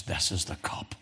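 hushed, voiceless whisper. Acted. Son of God telling of the cup, whispered. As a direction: breathe it as a secret.

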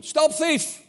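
high, ringing street-preacher shout; a sudden high cry to stop the crowd; shouting stop thief. Retold or imitated. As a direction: call out like a thief just ran past. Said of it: "I started shouting".

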